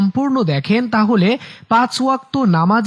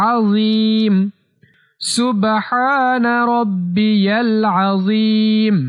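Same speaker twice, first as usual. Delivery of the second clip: slow, near-chanted Arabic with held vowels; steady-toned, measured recitation; instructional tasbih recitation; much slower than usual. Shown as a demonstration. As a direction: stretch the long syllables for learners to repeat. Teaches tasbih by repetition.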